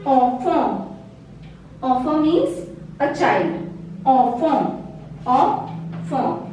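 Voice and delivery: enfant with nasal an, ahn-fahn, no T; French nasal sound. Shown as a demonstration. Teacher showing the class the nasal an in enfant. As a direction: let the an of enfant ring through the nose. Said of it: "This sound".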